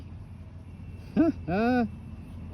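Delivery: airy unvoiced chuckle; a little delighted breathy laugh. Real, not acted. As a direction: laugh softly through the breath. Laughing at the Aussie toy.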